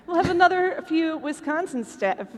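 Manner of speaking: ordinary delivery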